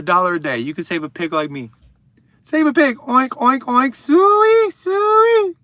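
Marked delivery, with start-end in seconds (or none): 2.4-3.9 high, loud oinking pig. Pretend. Pretending to be a pig in a charity appeal, high oinks. 3.9-5.5 very high, loud hog call; pig call. Pretend. Doing the pig call for a viewer, very high and loud. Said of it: "say Sui".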